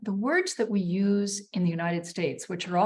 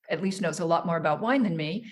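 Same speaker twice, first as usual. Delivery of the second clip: ordinary delivery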